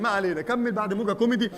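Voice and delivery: ordinary talk, nothing marked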